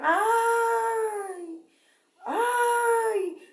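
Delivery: long, high-pitched wailing "ay"; gritos imitados. Retold or imitated. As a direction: imitate the drawn-out cries of the damned. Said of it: "gritaban y decían". (gritos de lamento imitados, agudos y alargados)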